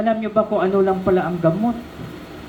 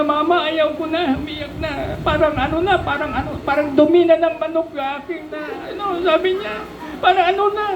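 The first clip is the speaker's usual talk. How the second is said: put-on crying child; 9 semitones higher than usual. Crying child voiced in story.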